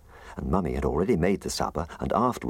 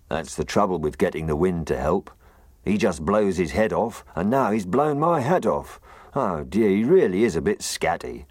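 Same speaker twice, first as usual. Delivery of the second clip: loud, rueful gruff snowman; 5 semitones higher, 5 dB louder than usual. Acted. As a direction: grumble about the scatty wind. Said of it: "He had a very gruff voice".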